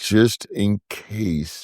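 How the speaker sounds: drawn-out, careful hedging; slow and deliberate, stalling a little; speaking really slowly. Real, not acted. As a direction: take your time on every word. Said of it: "I'm speaking really slowly".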